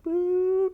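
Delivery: short cartoon boop, very high; high-pitched "boop". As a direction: squeak out a little boop. Imitated noise in the pitch.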